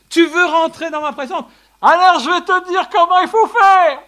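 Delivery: loud, high commanding divine voice; voice of God. Acted. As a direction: speak as God inviting them in. Voicing God to the listener. (voix de Dieu, forte et haute)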